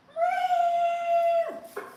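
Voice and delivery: high, loud cat meow; imitated cat meow. Telling viewers how Bruce woke her, a loud high meow. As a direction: meow like a cat demanding you wake up. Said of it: "he came in and he went".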